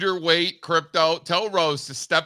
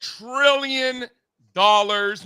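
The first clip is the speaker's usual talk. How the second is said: excited; 9 semitones higher, 6 dB louder, slower than usual. Real, not acted. Excited opening announcement.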